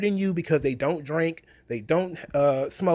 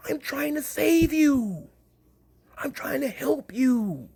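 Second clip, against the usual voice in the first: mock preachy saviour; 7 semitones higher than usual. Mocking a preachy activist lecturing fans, high and syrupy. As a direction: lay on fake pity like a condescending saviour.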